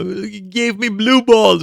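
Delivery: high, drawn-out imitation of whining; hammed-up strained whine; mock pained wail. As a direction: wail the accusation slowly, mocking the complainer. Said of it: "they keep running around and, g-, and go".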